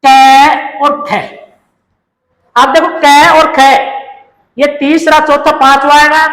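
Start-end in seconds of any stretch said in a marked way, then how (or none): 0.0-1.2 क और ख said very slowly; slow, deliberate listing; very slow pointing. Shown as a demonstration. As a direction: drag out क और ख for the class. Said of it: "अब देखिए पहला".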